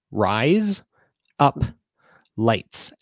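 slow, separate words with gaps; plainly spaced out for a learner to copy. Shown as a demonstration. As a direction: say each word alone, no accent. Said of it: "with no accent whatsoever".

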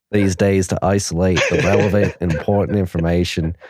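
hammed-up Aussie read, a bit amused; Australian accent. Host reading a listener's message, Australian accent. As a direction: put on your best Australian, slipping now and then. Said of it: "Must read in your best Australian accent".

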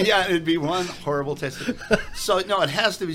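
talking through laughter; chuckling while explaining; laughing. Real, not acted.

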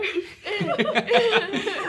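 high, drawn-out laugh. Real, not acted. Friends joking over the crying story, high laughter. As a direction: let out a long high laugh.

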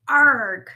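loud exasperated growl-yell; frustrated yell. A narrator's reading. Rabbit searching in vain, yelling in frustration. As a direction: burst out in exasperation.

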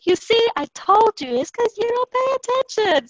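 high, loud mock mom scolding in English. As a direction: repeat mom's told-you-so.

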